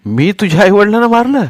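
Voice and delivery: high, loud accusing question; offended boy yelling; indignant yell. A narrator's reading. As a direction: shout it back in outrage, pitch climbing. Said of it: "सोन्या ओरडला".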